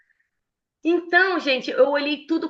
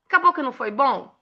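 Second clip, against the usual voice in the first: normal talking voice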